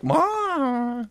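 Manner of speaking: a high, shocked cry of Mom; mock scandalized mom. Mother mocked as shocked by a public diaper change. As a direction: squeal it like a scandalized grandma. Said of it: "you and I think Mom are like".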